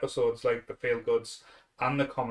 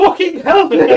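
loud, high, slow laughing yell; exasperated shout; 14 dB louder than usual. Retold or imitated. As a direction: cry out in mock despair. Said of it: "I lose me shit".

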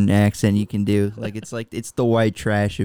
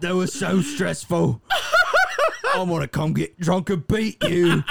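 higher Cockney, close to cracking up; Arthur; 6 semitones higher than usual. Acted. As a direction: whine to the wife in thick Cockney. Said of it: "Arthur, uh, and you have to go tell".

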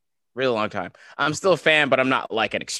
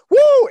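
a much higher, louder imitated whoop; 13 dB louder than usual. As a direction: belt out a sudden stage whoop mid-dance. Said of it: "He just, like".

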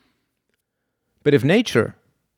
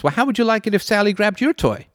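high, lilting scolding parent; 12 semitones higher than usual. Retold or imitated. Parent to child who grabbed a toy, mock scolding. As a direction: chide the child like a patient parent. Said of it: "and the parent says".

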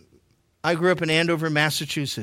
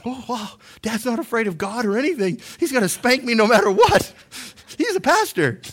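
high-pitched mock dismay of children; hammed-up kid voice, climbing higher; imitated alarmed kids; 7 semitones higher than usual. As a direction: play kids realizing Dad really means it. Imitating his alarmed kids' reaction.

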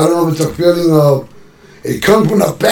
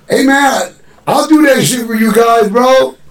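eager offer; 7 semitones higher than usual. Retold or imitated. Rapper eagerly offering to redo the hook for the crew. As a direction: jump in like you've got this. Volunteering eagerly to fix the song.

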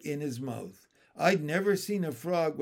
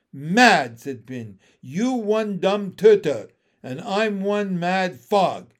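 loud frog insults; angry frog; 3 semitones higher, 8 dB louder, slower than usual. Acted. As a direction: spit it at the turtle.

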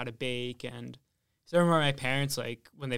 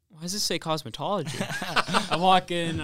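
laughing; 5 semitones higher, 7 dB louder than usual. Retold or imitated. Retold confused self, laughing.

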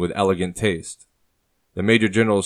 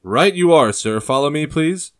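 eager foot soldier; 8 semitones higher, 5 dB louder than usual. Acted. Foot soldier answers officer.

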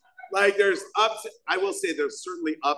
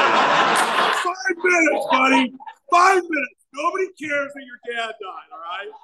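mock heartless timekeeper; slower than usual. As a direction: bark out the time limit in a mock-heartless yell. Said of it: "Boy, he's heartless".

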